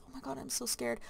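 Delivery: hushed, mock-frightened aside; scared aside. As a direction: drop the voice right down, pretend scared. Scared aside during the story.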